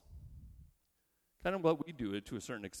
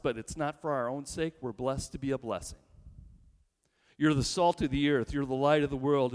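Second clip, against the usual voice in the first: normal talking voice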